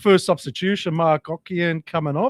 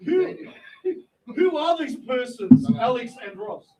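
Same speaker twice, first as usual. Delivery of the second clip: mock grand question; 10 semitones higher, slower than usual. Mock grand question about brewers.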